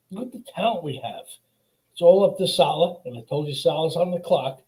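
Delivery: normal talking voice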